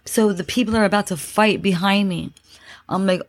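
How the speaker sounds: ordinary delivery, nothing marked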